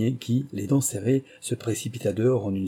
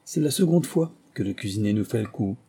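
usual speaking voice

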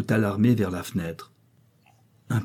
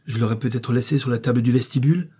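plain reading voice

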